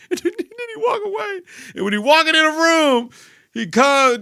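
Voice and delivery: high, laughing narration; barely holding back laughter. Real, not acted. Telling how Charles walks off to his room, laughing.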